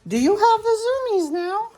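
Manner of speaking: high squeaky pet voice. Real, not acted. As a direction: gush at the dog playfully. Talking to the dog.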